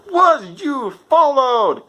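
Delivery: high, slow, sneering outlaw voice; mock Micah sneer. Micah questions Arthur, high slow sneer. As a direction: drawl it out like a suspicious gunslinger.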